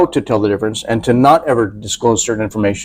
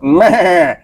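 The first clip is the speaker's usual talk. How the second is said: pig to be butchered; 3 semitones higher than usual. Retold or imitated. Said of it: "a pig just waiting to be butchered".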